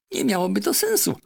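a clever parrot lecturing her master. Acted. Parrot Polynesia urging the doctor, high and insistent.

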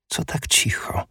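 hushed, high whisper; a breathless whispered question. Acted. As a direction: whisper it, uneasy at the silence. Sudden silence in the hall.

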